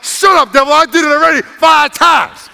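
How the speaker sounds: loud, high rebuke of the devil; defiant shout. Pretend. Believer answering the devil's doubts, loud defiant shout. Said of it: "you're like".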